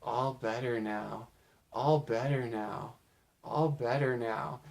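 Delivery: put-on slow, soft and eerie, like a possessed girl; creepy voice. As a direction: hush it out slowly and creepily as if something else is speaking. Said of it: "in a creepy voice says".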